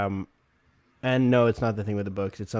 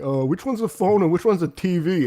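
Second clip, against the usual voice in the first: higher-pitched mock-confused questioning; clueless technician; 6 semitones higher, 4 dB louder than usual. Guy from Bell coming out confused, mock clueless. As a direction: play a clueless repairman, voice pitched up.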